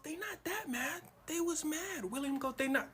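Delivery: high, breezy denial; dismissive Ruto. Acted. President waving off angry crowd, high breezy voice. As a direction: brush it off in a high silly voice. Ruto denies people are mad.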